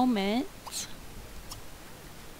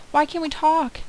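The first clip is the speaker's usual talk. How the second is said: exasperated and higher; frustrated; 4 semitones higher than usual. Real, not acted. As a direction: demand an answer, confused. Game won't allow talking.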